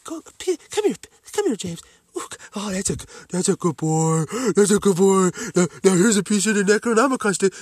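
mock pet owner luring a dog to fetch; talking to a dog. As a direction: call your dog over, then praise it, sing-song. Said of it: "I feel like a fucking dog fetching".

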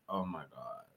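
very soft and low, under the breath; a low, hushed gasp of disbelief; hushed aghast. Retold or imitated. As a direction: drop to a stunned hush. Reacting to parents' last kiss.

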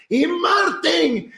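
high, stretched-out shouted name; announcer shout. Retold or imitated. Church crowd announcing Martín, drawn-out shout. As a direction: call the name like a stadium announcer. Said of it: "por fin gritan".

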